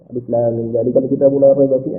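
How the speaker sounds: quick, soft, low recitation; hadar recitation. Shown as a demonstration. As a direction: run through the verse quickly and softly. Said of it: "itu hadar". (bacaan hadar yang cepat)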